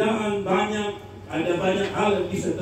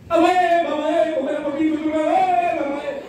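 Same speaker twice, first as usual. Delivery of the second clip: dramatic mock wailing; 7 semitones higher than usual. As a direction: overdo the grief like a theatrical mourner. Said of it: "dia mau menangis sudah lain". (ratapan keras yang dibuat-buat, nada tinggi)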